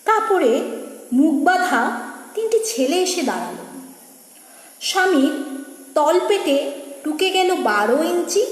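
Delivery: dramatic verse with lifted phrases falling away; poem recitation. Acted. Reciter telling of a husband stabbed, dramatic poem recitation. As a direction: recite in measured phrases with long pauses.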